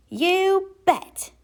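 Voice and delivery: put-on loud, high kid's yell; eager child yelling. A narrator's reading. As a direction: shout it out eagerly. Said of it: "yelled Lester".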